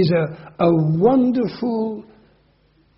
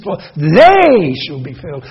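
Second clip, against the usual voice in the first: "they" hit much louder, then fall away; stress on they; 3 semitones lower, 10 dB louder than usual. Shown as a demonstration. Preacher reading Beatitude aloud, loud accent on "they". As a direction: punch "they" in "for they shall be comforted". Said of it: "we'd get it by accent".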